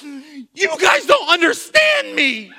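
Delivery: high, loud, slow mock tantrum yell; angry child shout. A daughter storming off to her room, mock angry shout. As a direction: yell it like a furious six-year-old. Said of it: "then finally she got so mad".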